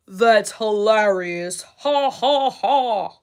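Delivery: loud, slow mock laughter; sarcastic fake laugh.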